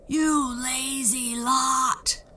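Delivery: slow, loud, high giant roar. A narrator's reading. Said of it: "roared the giant".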